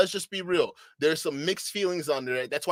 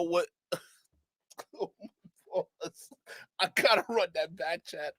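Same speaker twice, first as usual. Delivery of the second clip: normal talking voice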